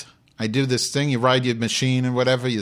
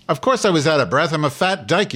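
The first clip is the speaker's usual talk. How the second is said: loud, high, fast outburst; indignant retort; 6 semitones higher, 6 dB louder than usual. Real, not acted. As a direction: fire back at a rude listener. Snaps back at breathlessness remark.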